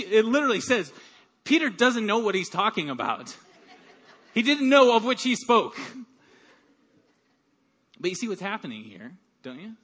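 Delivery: normal talking voice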